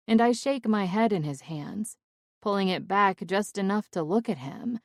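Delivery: usual speaking voice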